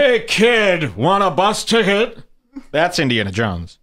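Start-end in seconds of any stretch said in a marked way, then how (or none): 0.0-2.2 mock hero line. Mock Indiana Jones line.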